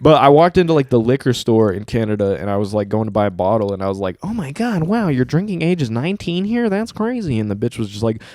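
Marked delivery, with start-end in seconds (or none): 4.2-7.4 high-pitched, gushing disbelief; amazed tourist. Retold or imitated. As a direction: marvel in a much higher voice. Acting out own amazed reaction.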